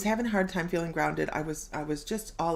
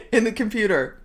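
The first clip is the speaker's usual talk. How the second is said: much louder, calling out in mock alarm; urgent warning; 4 semitones higher, 7 dB louder than usual. Host jokingly calling to Jude to stop, loud. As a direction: shout a warning across the room.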